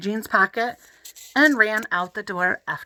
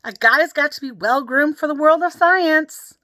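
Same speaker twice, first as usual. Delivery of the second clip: hollering back; 7 semitones higher, 4 dB louder than usual. A narrator's reading. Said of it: "I hollered".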